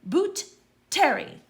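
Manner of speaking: loud, high roll-call announcement; calling out names. A narrator's reading. Professor calling the next pupil loudly to the stool. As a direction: call each name across the hall.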